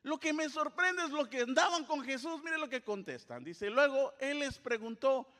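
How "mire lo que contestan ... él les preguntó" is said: ordinary talk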